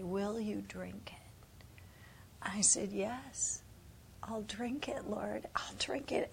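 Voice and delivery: soft unvoiced whisper; whispering yes to the Lord; hushed vow.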